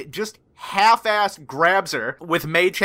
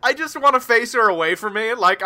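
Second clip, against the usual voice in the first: ordinary talk, nothing marked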